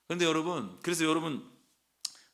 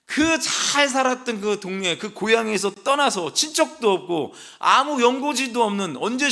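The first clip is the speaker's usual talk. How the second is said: impassioned husband; 6 semitones higher, 5 dB louder than usual. Pretend. Abraham pleading with wife.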